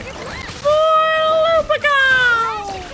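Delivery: high, loud, drawn-out war cry; Paimon's war cry. Retold or imitated. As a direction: shout it like a tiny battle cheer. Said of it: "Paimon's freaking war cry".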